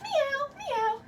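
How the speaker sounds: high, soft playful meows; cat meow. Pretend. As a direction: mew like a kitten. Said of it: "You can keep meowing if you like".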